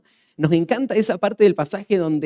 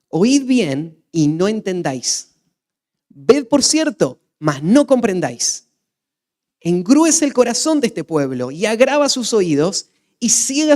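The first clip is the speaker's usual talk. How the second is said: forceful scripture reading; 5 semitones higher, 7 dB louder than usual. Acted. Preacher reading Isaiah's judgment to the congregation, loud and forceful. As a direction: proclaim it like a prophet pronouncing judgment.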